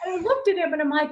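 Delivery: ordinary talk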